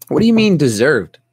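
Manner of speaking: high, loud incredulous challenge; mock disbelief. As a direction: throw the question back, loud and exasperated. Mock reaction to the article's claim.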